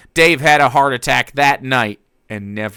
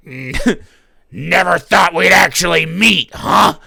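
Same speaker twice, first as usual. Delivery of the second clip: gleeful villain cackle, higher; cackling; 5 semitones higher, 5 dB louder than usual. Acted. Walrus greeting his rival with a cackle. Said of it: "the walrus cackled".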